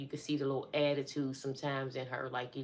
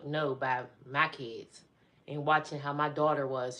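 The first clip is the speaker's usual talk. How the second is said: usual speaking voice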